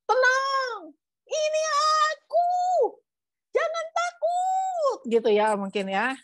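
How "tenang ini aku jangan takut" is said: very high, drawn-out calling voice; high acted holler over a storm; louder, over wind. Shown as a demonstration. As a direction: call across the wind, stretching each word. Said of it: "lebih keras ya kan angin".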